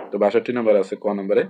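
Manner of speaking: ordinary delivery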